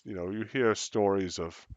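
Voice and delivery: usual speaking voice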